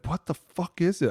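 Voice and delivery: high, sharp mock demand; imitating Chuck demanding; mock demanding coach. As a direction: bark it like a tough private coach.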